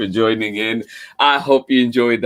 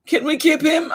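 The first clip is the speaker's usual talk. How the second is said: pleading ask; faster than usual. Acted. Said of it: "asked Mouse".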